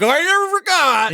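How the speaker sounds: high, loud, drawn-out protest; mock indignant viewer. Imitating that one guy at home, mock indignation. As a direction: slowly wail it like an affronted know-it-all.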